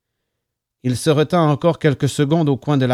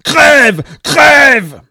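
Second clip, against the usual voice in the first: murderous high, loud scream; frenzied, drawn-out yelling; relentless screaming; 12 semitones higher, 12 dB louder than usual. A narrator's reading. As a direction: scream each word with full force. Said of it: "il n'arrêtait pas de hurler".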